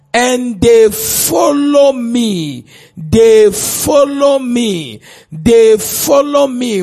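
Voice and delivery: slow, drawn-out stress on "follow me"; emphatic repetition. Real, not acted. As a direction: preach it slowly, stretching "follow me" each time. Preacher repeats the verse for emphasis.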